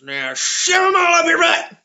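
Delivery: high, loud hillbilly yell; old farmer. Acted. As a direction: holler the order like an old farmer. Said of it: "The old man looks at him".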